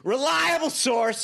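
slow, high mock-serious jab; drawn-out sarcastic aside. Host mocking the source, slow sarcastic.

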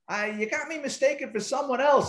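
feigned denial. Retold or imitated. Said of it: "Pretends like he doesn't know".

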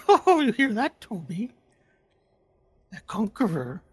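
high, sneering creature; giggling, scornful dark voice; mocking creature voice.

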